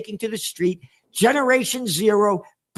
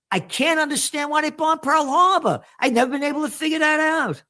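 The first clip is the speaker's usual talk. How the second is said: mock-puzzled sarcasm, pitched higher; sarcastic; 8 semitones higher than usual. Real, not acted. Forecaster mocking official story of Pearl Harbor, sarcastic. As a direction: play dumb with heavy irony.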